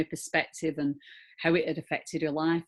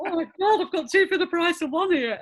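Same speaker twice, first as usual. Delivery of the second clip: delighted; 14 semitones higher, 8 dB louder than usual. Retold or imitated. Retelling her delighted reaction.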